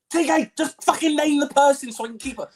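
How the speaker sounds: very high, strained frustration; hammed-up high-pitched exasperation; exasperated mock plea. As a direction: beg at the end of your patience. Acts out pleading with TK.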